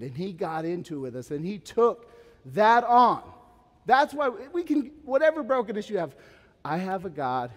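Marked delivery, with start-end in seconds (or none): 1.3-6.1 preaching peak. Acted.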